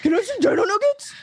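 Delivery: high, excited pleading; acted high-pitched, hopeful asking; eager begging. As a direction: beg for the treat like an eager dog. Excited wolf begs for nuggets.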